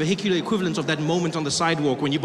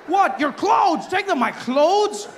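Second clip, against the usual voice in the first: high, loud bewildered back-and-forth; mock shouting match; 11 semitones higher, 4 dB louder, much slower than usual. Passenger and officer arguing, shouted disbelief. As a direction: yell the misunderstanding.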